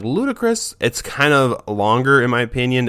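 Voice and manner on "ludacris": calling the dog. Shown as a demonstration. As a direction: call the dog by its long name in a high sing-song. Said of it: "If I'm like".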